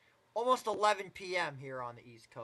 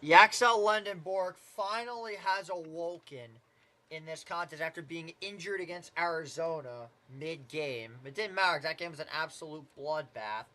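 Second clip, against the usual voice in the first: brisk, rising basketball call; live play-by-play. Acted. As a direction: call the game as it unfolds.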